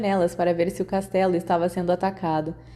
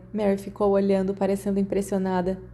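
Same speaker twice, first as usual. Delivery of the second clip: ordinary delivery, nothing marked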